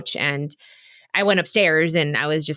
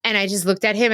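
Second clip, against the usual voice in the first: ordinary delivery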